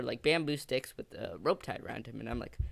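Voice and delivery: normal talking voice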